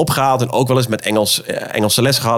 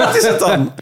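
laughing high question. Real, not acted. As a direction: ask it while laughing. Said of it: "laughing question".

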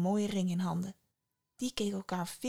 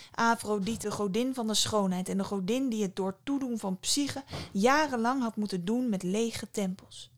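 usual speaking voice

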